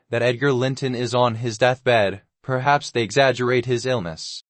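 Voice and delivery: ordinary delivery, nothing marked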